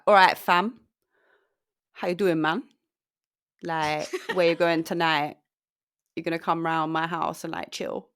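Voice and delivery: rough London street accent, loud and breezy; rude boy accent. Shown as a demonstration. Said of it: "a rude boy accent".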